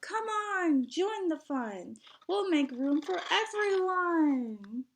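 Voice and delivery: high, loud, slow welcoming call; cheerful girl shouting an invitation; yelling out. Acted. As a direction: call everyone in at the top of her voice. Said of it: "Musa yelled out".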